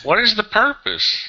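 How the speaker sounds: high-pitched, exasperated challenge; exasperated demand. Real, not acted. Host challenging a caller on the party, exasperated. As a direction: demand it, voice shooting up in real frustration. Heated exchange about party's purpose.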